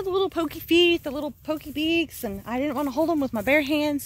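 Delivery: normal talking voice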